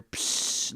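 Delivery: short sharp burst of hiss; imitated spray hiss. Retelling the moment he sat on the can, imitated hiss. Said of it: "we hear".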